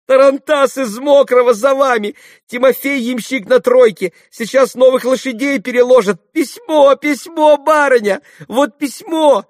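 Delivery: very high, loud, breathless excitement; joyful shouting. A narrator's reading. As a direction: cry out the news to your mistress. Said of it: "пока кричала".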